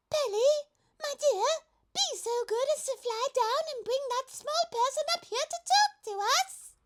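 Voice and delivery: very high, gentle, lofty giraffe. A narrator's reading. Giraffe politely asks the pelican to fetch the boy, very high. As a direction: speak sweetly from high above.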